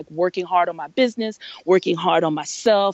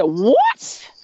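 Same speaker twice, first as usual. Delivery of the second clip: much higher, louder rising what; shocked what; 5 dB louder than usual. Retold or imitated. Woman hears the adjuster claim her, much higher and shocked. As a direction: react in disbelief.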